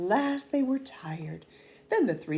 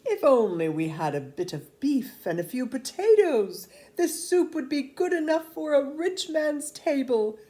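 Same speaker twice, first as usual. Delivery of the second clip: higher, louder coaxing soldier voice; tempting soldiers; 8 semitones higher, 7 dB louder than usual. Acted. Soldiers luring the peasants to bring beef, coaxing. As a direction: dangle the rich man's table before them, voice lifting.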